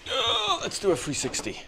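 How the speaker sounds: ordinary talk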